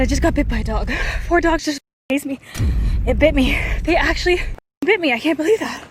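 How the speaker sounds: loud, high, panicked outburst; shaken and breathless. Real, not acted. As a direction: blurt it out in real shock, winded. Just bitten while fleeing dogs.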